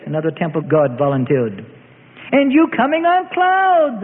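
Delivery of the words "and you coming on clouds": high, drawn-out scoffing; jeering. A narrator's reading. Mob member ridiculing the prisoner's prophecy, jeering. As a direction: scoff slowly in a high mocking voice. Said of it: "another put in, and all laughed".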